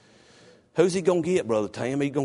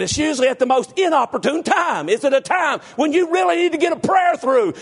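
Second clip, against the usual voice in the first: high, loud, driving pulpit cry; full-force preaching; 11 semitones higher, 4 dB louder than usual. Acted. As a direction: build to an urgent revival-style pitch.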